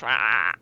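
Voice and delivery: low mock jaguar roar; half-hearted roar in a low voice. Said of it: "supposed to make a jaguar noise".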